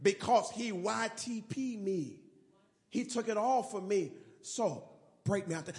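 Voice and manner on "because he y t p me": usual speaking voice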